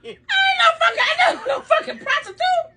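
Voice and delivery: indignant, laughing protest; laughing denial. Real, not acted. Mother denies the essay's claim in a high, laughing shout.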